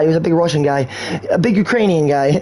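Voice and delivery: usual speaking voice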